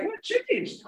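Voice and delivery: high, amazed question; surprised question. Retold or imitated. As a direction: ask in sudden wonder. [name] retells his own question.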